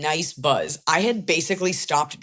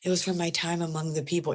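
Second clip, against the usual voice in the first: hushed, mock-pretentious worldly traveler; airy, self-important faux mystique; 5 dB quieter than usual. Pretending to impress a guest asking about art, airily.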